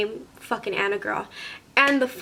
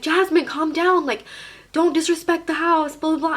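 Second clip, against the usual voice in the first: higher, pleading mock-friend voice; scolding friends; 6 semitones higher than usual. Friends telling Jasmine to calm down, scolding. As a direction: imitate friends trying to rein someone in.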